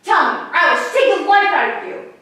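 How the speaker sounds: full-force high shouted threat; furious acted shout, very loud; raging drunken father. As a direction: roar it at the boy while shaking him. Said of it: "He commenced to shake me awful".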